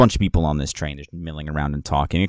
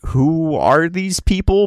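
mock snooty, higher and slower; snooty passengers; 10 semitones higher, 5 dB louder, slower than usual. Passengers eyeing the scruffy party, mock snooty. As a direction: sneer at the newcomers. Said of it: "everybody's kinda looking at you like".

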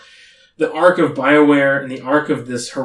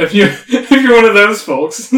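quick, louder words cracking into laughs; breaking into laughter; 6 semitones higher, 9 dB louder than usual. Real, not acted. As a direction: lose it mid-sentence. Plug cue dissolves into laughter.

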